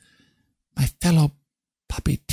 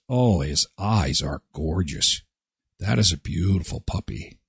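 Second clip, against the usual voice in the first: cooing; 9 semitones lower, 5 dB quieter than usual. Real, not acted. Cooing over puppy photo.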